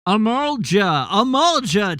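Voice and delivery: calling out a name. Acted. Calling out for help.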